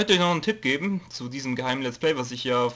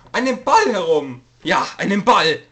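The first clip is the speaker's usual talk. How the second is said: mock excited; 6 semitones higher, 8 dB louder than usual. Excited about silly ball prank.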